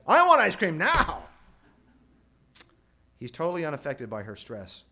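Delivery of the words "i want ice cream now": high, loud, drawn-out brat yell; demanding child. Acted. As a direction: shriek the demand like a tantruming boy. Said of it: "you know what he says?".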